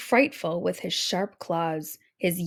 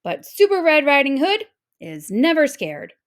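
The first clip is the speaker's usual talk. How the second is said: louder and higher, proud superhero boast; triumphant brave proclamation; bold declaration; 5 semitones higher, 6 dB louder than usual. A narrator's reading. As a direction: announce it bravely, chest out. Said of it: "Ruby said to herself".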